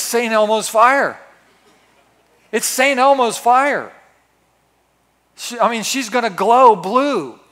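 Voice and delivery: dramatic building. Acted. Preacher warning his listeners the strike of power is coming, loud and awed. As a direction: build it like a storm about to break.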